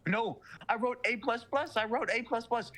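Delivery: high, frantic insistence; panicked denial. Retold or imitated. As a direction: plead it in rising panic. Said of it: "the guy says".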